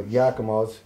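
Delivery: ordinary delivery, nothing marked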